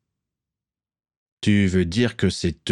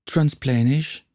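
slow, winded and higher; breathed out; 7 semitones higher, much slower than usual. Acted. Sick Dennis, head between knees, breathes the word out. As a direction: gasp the word out dizzily. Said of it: "souffla-t-il".

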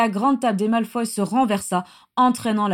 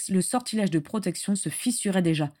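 ordinary delivery

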